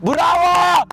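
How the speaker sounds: triumphant football cheer; cheering shout. Real, not acted. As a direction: shout it out with joy.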